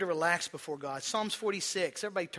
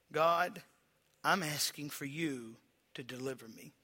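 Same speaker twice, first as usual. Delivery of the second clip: prayer; 4 semitones lower, slower than usual. Acted. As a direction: pray low and slow, pleading for deliverance. Pastor prays aloud before congregation.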